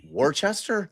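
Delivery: Worcester said as wor-chester, slow and unsure; laughing, slow Worcester as wor-chester; wrong guess. Shown as a demonstration.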